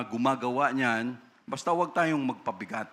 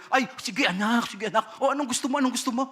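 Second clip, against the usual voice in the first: much higher, sweet coaxing voice; doting parent; 10 semitones higher, faster than usual. Retold or imitated. Parent giving in to crying child, high coaxing. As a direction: coo at the child to calm her.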